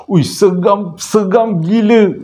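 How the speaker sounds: loud slow scared exclaim; freaked out. Retold or imitated. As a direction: drag out how creepy it is. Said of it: "Dia kata".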